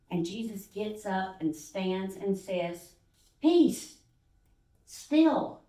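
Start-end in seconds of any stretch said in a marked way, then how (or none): 3.4-5.6 loud, high command to the storm; commanding calm. Acted. Jesus commanding the storm, loud and high. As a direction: order the wind to stop.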